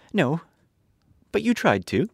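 put-on high girl's voice, snapping back; a child firing back at the lion; little girl retorting. A narrator's reading. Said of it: "she retorted".

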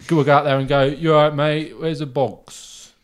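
ordinary delivery

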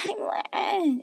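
high, coy little introduction; sweetly girlish mock shyness; coy reply.

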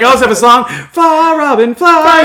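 high, loud proud announcement; high excited singsong. Retold or imitated. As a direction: pitch it up like an eager kid. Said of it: "he's like".

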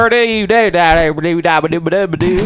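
loud, high nonsense syllables imitating a banjo; mouth banjo. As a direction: play a banjo with your mouth. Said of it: "play the banjo with my mouth".

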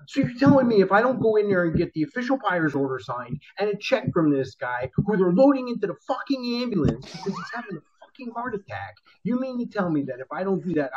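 high, disbelieving rant; acted-out outrage at the boss; incredulous retold protest.

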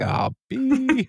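high, comic dismayed outburst; mock dismay. Ron hearing the worst possible news, high mock dismay. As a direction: blurt it out in mock horror.

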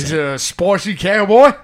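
hammed-up English accent. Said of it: "I don't like that English accent".

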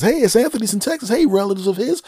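very high, fast, loud phone voice; Texas friend. Retold or imitated. Friend calling relatives, high fast excited voice.

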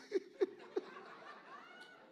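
long high-pitched laugh. Real, not acted. Preacher amused after telling men to learn apologizing, laughing.

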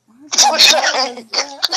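normal talking voice

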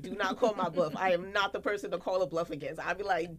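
normal talking voice

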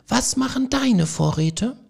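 small field mice calling up to Frederik; squeaky mouse voices. A narrator's reading. The mice ask idle Frederik, in high squeaky voices.

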